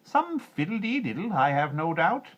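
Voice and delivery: put-on a bizarre little man roaring at the girl. A narrator's reading. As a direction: bellow it as a cranky imp. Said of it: "he roared".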